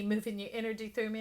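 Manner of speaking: ordinary delivery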